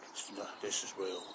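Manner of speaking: soft, low, deliberate warning; ominous dog. Acted. The dog Rover warns Dave it's real, ominous and quiet. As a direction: let the threat sink in.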